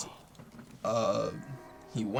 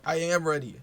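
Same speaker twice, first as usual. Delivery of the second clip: louder, higher protest; insistent retort; 7 semitones higher, 5 dB louder than usual. Retold or imitated. Kid answers dad who yelled at him, insistent retort. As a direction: push back against the accusation.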